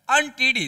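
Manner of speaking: अनटीडी said loud and crisp, opening on a clear अ; pronunciation demo. Shown as a demonstration. Teacher showing students how अनटीडी begins with अ. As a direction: call out अनटीडी with a sharp अ at the start. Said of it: "देखिए इसका उच्चारण कैसे हो रहा है".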